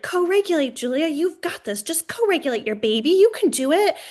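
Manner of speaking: high, urgent coaching of herself; frantic self pep talk. Retold or imitated. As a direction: hype yourself up, near panic. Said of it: "I'm like".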